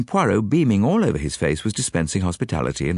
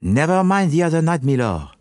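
put-on higher-pitched French-accented interruption; Poirot breaking in; 8 semitones higher, 4 dB louder than usual. A narrator's reading. Detective cuts off the lord's answer, interrupting. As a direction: cut in briskly with a French accent. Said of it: "broke in Poirot".

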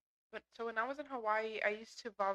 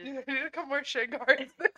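laughing; 4 semitones higher, 8 dB louder than usual. Real, not acted. Joking after cat scratch.

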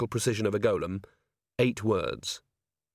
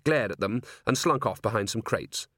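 usual speaking voice

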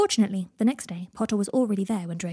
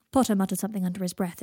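ordinary delivery

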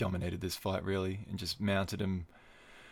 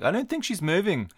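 mock fighter; 10 semitones higher, 4 dB louder than usual. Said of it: "And de Rondamel's like".

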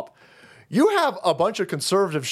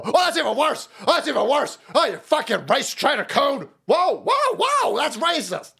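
high, hammed-up outraged voice; hammed-up outrage; mock shrieking accuser; 7 semitones higher than usual. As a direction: screech it like a hysterical accuser. Mocking accuser voice.

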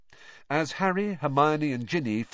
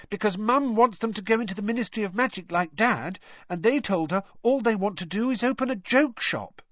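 higher, lively little sister voice; lightly voiced young girl; 12 semitones higher, 4 dB louder than usual. A narrator's reading. Younger sister telling Harry about the twins, lightly voiced girl. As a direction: play the younger sister, bright and chatty.

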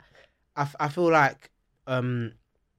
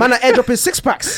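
higher and louder joking exclaim; excited; 10 semitones higher, 11 dB louder than usual. Real, not acted. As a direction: throw it out, amused and loud. Joking about fake abs.

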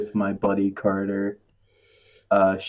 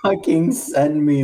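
laughing; 7 semitones higher than usual. Real, not acted.

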